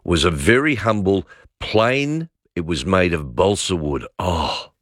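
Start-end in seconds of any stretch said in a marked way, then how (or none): none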